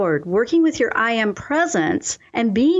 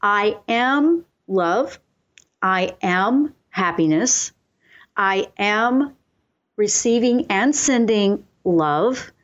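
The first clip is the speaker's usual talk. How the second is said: slow affirmations; slower than usual. Shown as a demonstration. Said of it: "by saying".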